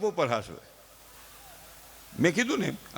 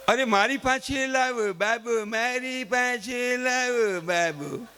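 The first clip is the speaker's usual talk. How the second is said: slow, drawn-out coaxing, a little high; coaxing father; 4 semitones higher, much slower than usual. Retold or imitated. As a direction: stretch the words, calling for the baby. Father coming home coaxing baby. (ધીમે લંબાવીને બાળકને બોલાવતો બાપ)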